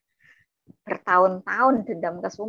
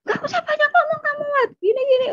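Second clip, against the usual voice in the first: high, fast, furious rebuke, imitating older sibling; angry; 5 dB louder, faster than usual. Older sibling silences younger one, high fast angry shout. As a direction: shut them up in a shrieking rush. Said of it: "Wes, marah".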